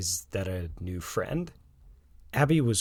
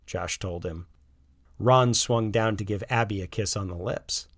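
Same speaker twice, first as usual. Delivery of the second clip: usual speaking voice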